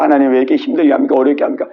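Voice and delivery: low, rapid monotone grumble; grumbling complaint. Acted. Believers griping to God about hardship, grumbling. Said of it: "우리가 막 원망 불평하잖아요".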